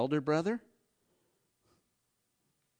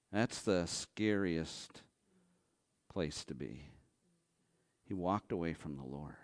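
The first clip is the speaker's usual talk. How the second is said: hushed solemn; 7 semitones lower, 6 dB quieter than usual. Real, not acted. Preacher warns the congregation in a hushed whisper. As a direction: let it sink in quietly.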